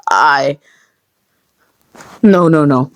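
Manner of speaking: ordinary delivery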